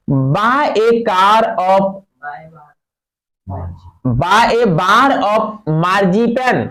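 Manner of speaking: extremely slow, word by word; slow reading aloud. Shown as a demonstration. Teacher reading the passage to students, extremely slow. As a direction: dictate each word. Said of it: "reads the next sentence slowly".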